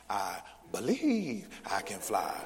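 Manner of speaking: mock recitation leaning on BELIEVE; stress on BELIEVE. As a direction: draw out "believe" in a mock drill. Mock drill, emphasis moved to believe.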